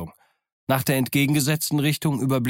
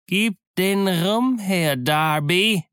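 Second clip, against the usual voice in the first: high, slow, louder cursing ghost cry; louder, higher ghostly wail; 8 semitones higher, 4 dB louder, much slower than usual. Acted. The dead captain's voice demands rum, louder and higher. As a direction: howl the demand like a drunken ghost. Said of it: "dann etwas lauter und höher".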